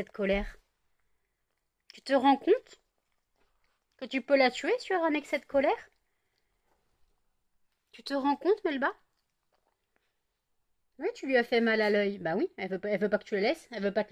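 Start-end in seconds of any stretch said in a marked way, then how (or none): 1.9-12.2 raised voice lecturing the cat; loud indignant telling-off; scolding. Real, not acted. (sermon indigné au chat)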